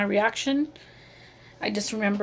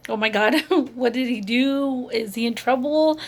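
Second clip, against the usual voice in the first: louder, worried racing questions; retold alarm; 3 semitones higher, 6 dB louder than usual. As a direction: relive a mother's alarmed thoughts aloud. Retold panicked thoughts of a mother.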